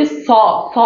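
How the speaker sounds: স said with a distinct English S; dental s. Shown as a demonstration. Teacher asks pupils to note the sound, dental s. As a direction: isolate স as a hissed s, not sh. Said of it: "স উচ্চারণটা ভালো করে খেয়াল করো".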